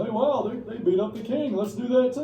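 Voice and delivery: high, loud mock excitement; excited imitation. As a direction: act out an eager crowd getting a big idea. Voices the excited French people.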